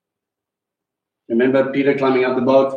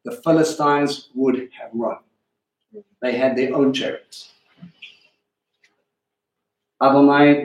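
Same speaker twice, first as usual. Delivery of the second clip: scripture reading; 4 semitones higher than usual. Acted. Reads scripture aloud.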